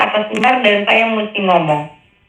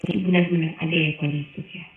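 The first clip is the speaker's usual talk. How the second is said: soft wondering; 4 semitones lower, 10 dB quieter than usual. Retold or imitated. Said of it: "Saya bilang gitu".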